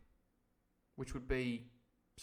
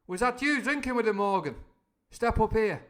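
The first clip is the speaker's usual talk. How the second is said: loud summons; 8 semitones higher, 10 dB louder than usual. Acted. Silver summoning Morgan.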